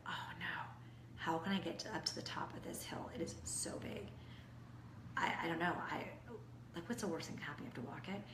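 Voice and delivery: whispered, unsure and worried; doubtful whisper. Retold or imitated. Runner at the foot of a hill, doubting herself, whispering. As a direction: hush it like a runner who fears the hill.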